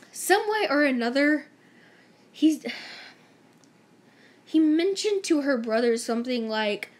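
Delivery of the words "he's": usual speaking voice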